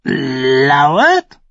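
high, loud croaking echo of the raven; screeching raven. A narrator's reading. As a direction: caw the word back like a crow. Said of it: "kreischte der Rabe".

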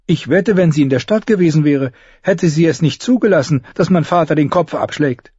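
put-on high, urgent girl's voice; a young girl getting upset.

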